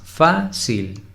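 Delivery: "Fácil" in two separate beats, high FÁ, then cil; split into syllables. Shown as a demonstration. Teacher counting two syllables, said separately. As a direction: break "Fácil" apart: fá, cil. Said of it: "Fácil tiene dos sílabas".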